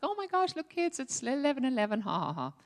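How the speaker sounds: high-pitched start easing down into mock laughter; amused exclamation. Mom showing kids eleven eleven, amused exclamation. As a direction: exclaim it high, then trail into ha-ha.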